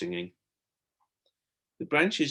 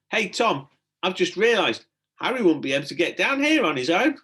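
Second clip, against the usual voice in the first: higher, louder call up the shaft; 8 semitones higher, 6 dB louder than usual. A narrator's reading. Stella to Tom from the ladder, calling up. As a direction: call it up to him above. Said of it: "called up".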